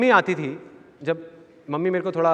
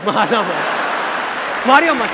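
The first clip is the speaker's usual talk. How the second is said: rising, louder frantic pleading; bystander pleading; 3 semitones higher, 4 dB louder, slower than usual. Retold or imitated. Bystander begs two fighters not to hit, rising to a shout. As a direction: plead louder and louder to stop them. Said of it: "कहता है".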